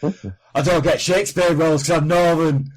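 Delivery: lightly voiced northern complaint; a hint of a grumbling northern actor; Eccleston impression. Mocking Christopher Eccleston's complaint, lightly northern. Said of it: "You heard his latest thing?".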